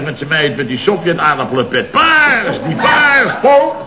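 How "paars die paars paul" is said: shouting. Acted. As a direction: yell it across the room at Paul. Shouting colours at the show.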